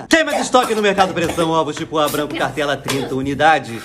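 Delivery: announcer patter dropping low and slowing at the end; supermarket sale announcer. Retold or imitated. Store announcer with egg offers over a meditation, announcer voice.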